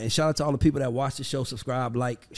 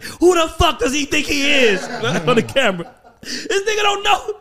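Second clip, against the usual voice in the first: louder and higher, laughing imitated outburst; mock furious rant; 12 semitones higher, 6 dB louder than usual. Mentor rants on camera about arrogant young Ray, laughing mock fury. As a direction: do the mentor fuming behind his back.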